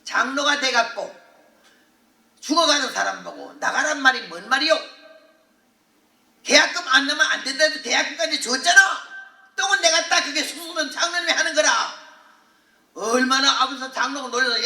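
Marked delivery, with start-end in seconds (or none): 0.0-11.7 loud indignant scolding, pitch up; tough woman scolding. Retold or imitated. Deaconess scolding the elder for abandoning the dying patient, loudly. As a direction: tear into him like a feisty country woman. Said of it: "전라도 해남의 고향인데 억순이거든".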